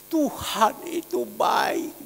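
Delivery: slow, drawn-out, mildly tearful praise; retold tearful testimony. Goods owner telling the pastor of answered prayer, mildly tearful. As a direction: stretch it out like someone moved to tears. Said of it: "Dia nangis".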